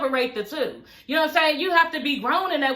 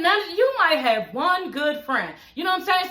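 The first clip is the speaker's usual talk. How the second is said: ordinary delivery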